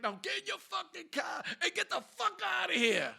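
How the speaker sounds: high, loud imitated dismissal; yelling cop. Cop letting the driver go, furious mock yell. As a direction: roar the order like a fed-up officer. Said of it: "he's t-, yelling, screaming at them".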